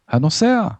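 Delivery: high, sudden captain's cry; alarmed shout. A narrator's reading. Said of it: "צעק". (צעקת בהלה גבוהה)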